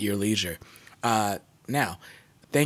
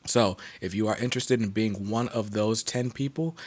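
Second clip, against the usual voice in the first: ordinary delivery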